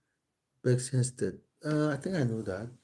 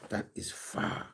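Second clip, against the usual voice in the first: breathy whisper after a sigh; 6 dB quieter, slower than usual. Real, not acted. Streamer muttering to himself about the distance, whispered. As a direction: hush it, half to yourself.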